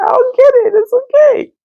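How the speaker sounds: squeaky high "I'll get it"; smooth it over in a tiny voice; mock-meek high squeak; high placating.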